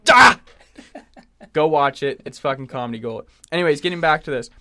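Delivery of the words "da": sudden loud, high yelp; imitated outburst. Co-host mimicking the noise from the clip, explosive yelp. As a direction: blurt out one explosive high shout. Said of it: "he just goes".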